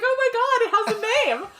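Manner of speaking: very high squeal of delighted recognition, laughing; excited retold exclamation. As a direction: squeal it high, imitating your own thrilled reaction. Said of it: "so I was like".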